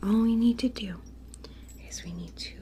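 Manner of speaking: tender ASMR whisper to a stuffed bear. Acted. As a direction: whisper gently like a toy doctor soothing a patient. ASMR whisper teddy surgery roleplay.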